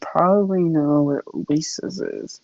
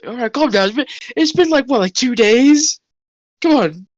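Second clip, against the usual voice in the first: dismissive guard; 6 semitones higher than usual. Acted. Elijah dismissing captive.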